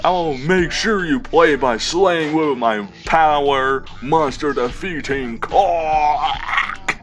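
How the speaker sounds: gloating hunter. Acted. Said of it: "He wasn't the real Van Helsing".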